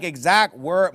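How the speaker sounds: ordinary delivery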